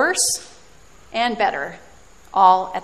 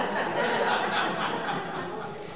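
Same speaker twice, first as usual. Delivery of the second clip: soft breathy laugh; 10 dB quieter than usual. Real, not acted. Laughs at her own voice.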